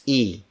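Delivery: I said as "ee"; Filipino I. Shown as a demonstration. Teacher models the letter I for learners, "ee". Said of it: "For the letter I".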